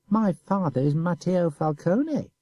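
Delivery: slow, high, each word weighted; proud emphasis. A narrator's reading. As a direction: stress 'My father' proudly. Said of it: "said he with emphasis".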